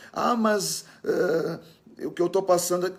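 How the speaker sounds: high, hesitant mock objection; protesting believer. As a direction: stammer out a doubter's complaint, pitched high.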